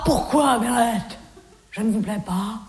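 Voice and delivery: low, deep mock male voice; Jean-Loup's voice. Jean-Loup wrongly thinks she likes it, in a low mock-male voice. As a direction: purr it like a smug suitor.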